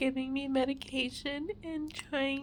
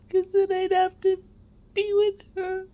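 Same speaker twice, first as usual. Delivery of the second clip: crying, higher and louder; snotty crying; 6 semitones higher, 8 dB louder than usual. Real, not acted. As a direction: voice breaking into tears. Tearful confession about fear of baby.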